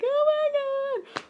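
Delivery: a slow, squealing high "Oh my God"; panicked brother. Retold or imitated. Brother running downstairs bleeding, squealing high in panic.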